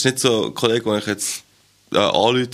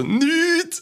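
Nichts squeaked way up high; squeaky high Nichts. Retold or imitated. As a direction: pitch the word Nichts up into a squeak. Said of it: "die Tonlage vom Nichts".

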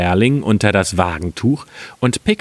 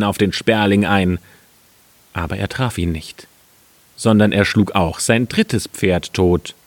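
ordinary delivery

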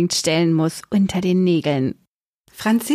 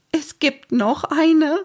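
high, slow, near-tearful question; close to tears; 9 semitones higher, slower than usual. A narrator's reading. Mama hears of another surprise, close to tears. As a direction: draw it out high as if about to cry. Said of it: "klang, als würde sie gleich weinen".

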